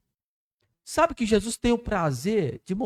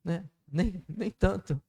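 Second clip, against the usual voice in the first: shy self-talk; 6 dB quieter than usual. Retold or imitated. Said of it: "olha no espelho e fala assim".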